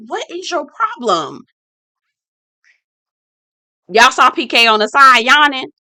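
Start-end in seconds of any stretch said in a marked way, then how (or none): 0.1-1.4 high, exasperated what is your problem. Real, not acted. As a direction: throw up your hands. Exasperated aside.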